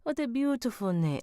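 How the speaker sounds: Italian lilt, leaping high on the second phrase; attempted Spanish accent slipping into Italian; Italian-sounding accent. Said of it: "We did Italian last time".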